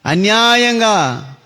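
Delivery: slow, stretched cry; drawn-out wail. Acted. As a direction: stretch each syllable in lament. Abel's blood cries to God. (సాగదీసిన ఆర్తనాదం)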